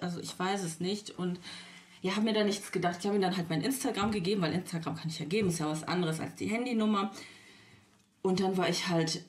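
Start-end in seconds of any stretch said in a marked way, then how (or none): none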